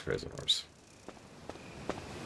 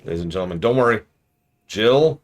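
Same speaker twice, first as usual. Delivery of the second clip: showy announcement, key words punched high and loud; mock announcer; 4 semitones higher, 6 dB louder than usual. Reassuring ladies and gentlemen about Jill, mock announcer style. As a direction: lift and punch the middle, then drop for the ending.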